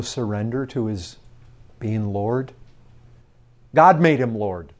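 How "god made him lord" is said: full-force preaching. Acted. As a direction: press the warning home to the congregation.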